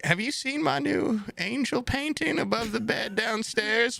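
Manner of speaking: put-on high, sweet old-lady voice; grandma voice. Grandson imitates grandma asking about her painting, high and sweet. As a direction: mimic a frail grandma showing off. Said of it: "she was just like".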